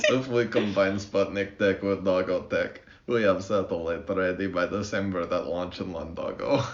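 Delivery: hammed-up Russian accent with broken English. Host showing his co-host how a Soviet engineer sounds, in a thick Russian accent. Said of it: "your pure talent for doing Russian, Russian accents".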